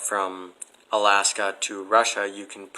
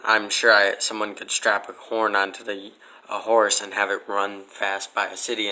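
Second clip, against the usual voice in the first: ordinary talk, nothing marked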